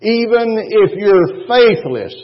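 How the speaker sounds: slow, high and loud; preaching emphasis. Acted. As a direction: stretch it out to move the church. Preacher slows and rises.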